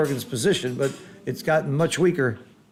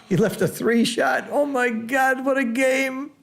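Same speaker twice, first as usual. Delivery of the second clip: high-pitched commentator astonishment; thrilled, high "oh my God"; excited amazement; 9 semitones higher than usual. Real, not acted. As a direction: marvel at the play, pitch climbing and held high. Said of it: "I really was excited".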